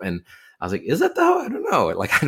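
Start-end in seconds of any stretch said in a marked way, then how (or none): 0.8-1.9 high squeaky quizzical voice; mock-puzzled, pitched way up; incredulous shrug. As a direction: toss it off way up high, unsure. Mock-doubting reply to sympathy.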